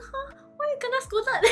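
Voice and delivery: high, startled squeal of disbelief; shocked viewer. Retold or imitated. Viewer hearing the streamer scolded, a high shocked squeak. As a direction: play the stunned viewer, pitch shooting up.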